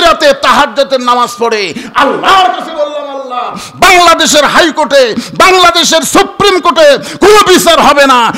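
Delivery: high, loud, fiery sermon; thundering preacher's appeal, sustained shout; impassioned preaching. Acted. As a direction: cry out a plea to God at full force. Preaches at full force, retelling a prayer.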